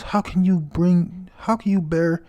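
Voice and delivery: high, pleading yell; screaming. Retold or imitated. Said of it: "As he screams".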